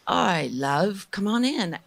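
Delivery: slow, drawn-out English Jamaican "love"; English Jamaican impression, slow start lifting at the end; English Jamaican accent. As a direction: imitate an easygoing English Jamaican welcome. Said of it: "this English Jamaican dude".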